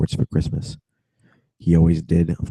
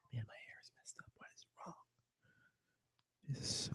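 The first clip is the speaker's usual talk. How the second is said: whispered aside, away from the mic; breathy muttered complaint to herself; whispering about messy hair; 6 semitones higher, 30 dB quieter than usual. Real, not acted. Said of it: "fixing hair, whispering".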